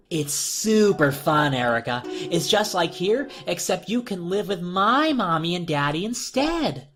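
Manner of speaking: put-on slow, high sing-song child voice; creepy doll girl. A narrator's reading. Doll girl selling the in-between place, sing-song. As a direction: sell it sweetly and slowly.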